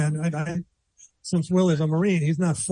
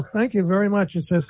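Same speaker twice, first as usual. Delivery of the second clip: normal talking voice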